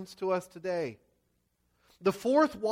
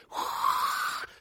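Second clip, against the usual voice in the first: imitated howl of wind, all breath. Preacher setting the windy night on the lake, howling wind. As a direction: blow a long breathy gust like wind. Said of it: "the wind is howling".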